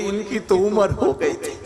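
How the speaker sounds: lightly put-on tearful voice; mock tearful. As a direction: imitate a weeping wife making her excuse. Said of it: "पत्नी रोती हुई बोली".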